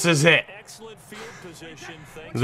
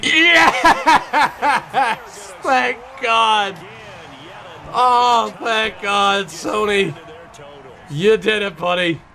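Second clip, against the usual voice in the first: elated shout; 7 semitones higher, slower than usual. Real, not acted. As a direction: shout with relief as he scores. Touchdown scored, celebrating.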